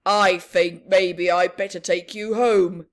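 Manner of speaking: stern fire chief. Acted. Chief's firm decision.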